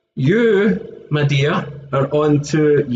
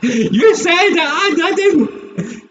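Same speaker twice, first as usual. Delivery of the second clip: laughing; 12 semitones higher, slower than usual. Real, not acted. Laughing denial to Charlie.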